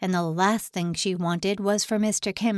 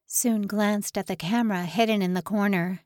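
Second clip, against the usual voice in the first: plain reading voice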